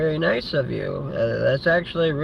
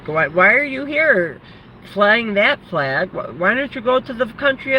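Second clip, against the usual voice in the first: indignant challenge; 5 semitones higher than usual. Real, not acted. Ranting at flag flyers in America, an indignant challenge. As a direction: call them out directly, drawing out the last words.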